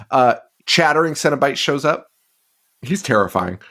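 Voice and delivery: ordinary talk, nothing marked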